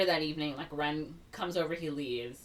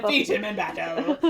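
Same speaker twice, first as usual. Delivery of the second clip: louder, higher, through laughter; mock-grand; 5 semitones higher, 7 dB louder than usual. Repeating the silly phrase to a friend, laughing mock-grand. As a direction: proclaim it grandly while laughing.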